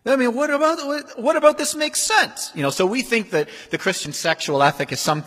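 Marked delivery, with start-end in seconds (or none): 0.0-2.5 much higher, louder disbelieving cry; incredulous. Retold or imitated. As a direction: blurt out that it makes no sense. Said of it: "And the culture is like".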